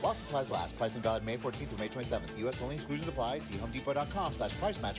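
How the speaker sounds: rapid-fire legal disclaimer; super fast ad read. Acted. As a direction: rattle off the fine print quickly.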